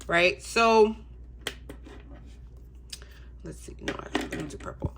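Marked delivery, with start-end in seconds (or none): none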